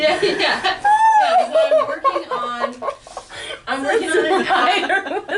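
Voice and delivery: giggling through the line, pitch jumping up; can't stop laughing while restarting the joke. Real, not acted. Comic breaking up as she restarts her bit, laughing.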